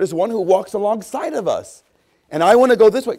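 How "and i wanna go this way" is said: loud, high, wilful burst; headstrong insistence. Acted. Preacher playing himself wanting his own way, loud insistence. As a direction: push ahead loudly, high and insistent. Acts wilful self against the Spirit.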